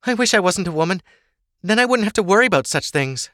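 high-pitched wishful complaint; complaining daughter. Acted. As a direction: lament your lot to your mother, pitched high. Ava complains about marriage.

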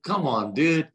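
retold whiny plea, pitch up; mock-pleading complaint; exasperated come on. Retold protest to God.

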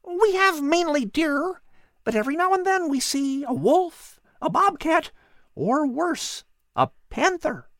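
high, chirpy bird voice; a tiny bird listing its visitors; little bird Pip. Acted. Bird Pip answering the cat in a high chirpy voice.

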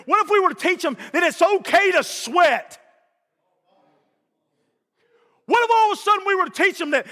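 high-pitched, driving pulpit cadence; rousing, rhythmic sermon delivery; fervent preaching. Real, not acted. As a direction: pile up the questions with a preacher's fire. Preaching at full force.

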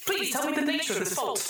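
high, bright AI announcer; the computer rewarding the troubleshooters, in a chirpy synthetic voice; cheery computer voice. Acted. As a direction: sing out the congratulations like a cheerful machine.

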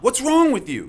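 high loud frustration; frustrated shout. Retold or imitated. Medic yelling at a patient who won't be carried, frustrated. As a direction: snap at him. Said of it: "You know".

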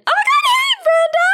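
very high, loud squealed greeting; Facebook Live impression. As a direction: squeal at a viewer joining the livestream. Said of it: "My Facebook Live impression".

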